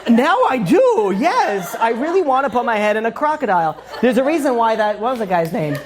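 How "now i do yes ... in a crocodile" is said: comically overkeen, starting high and stretched; mock eager agreement. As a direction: jump at a daft invitation with hammed-up delight. Gleeful reply to the Australian's invitation.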